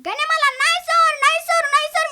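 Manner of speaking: put-on high, loud protesting kid voice; a boy pleading with his teacher; pleading schoolboy. Boy to teacher, loud high protest.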